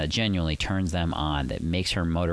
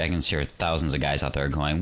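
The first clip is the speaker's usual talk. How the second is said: ordinary talk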